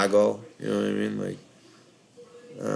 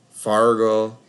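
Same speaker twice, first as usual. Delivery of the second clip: "Fargo" louder with a sounded R, Upper Midwest accent; 5 dB louder than usual. Shown as a demonstration. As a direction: say "Fargo" in a light Midwestern accent with a full R and round O. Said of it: "like, that accent".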